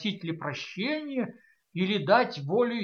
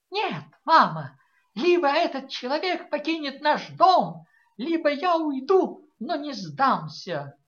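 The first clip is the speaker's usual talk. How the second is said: high, loud stage-heroine ultimatum; theatrical pathos; 9 semitones higher, 6 dB louder than usual. A narrator's reading. A daughter declaims to her mother with theatrical pathos. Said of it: "с театральным пафосом".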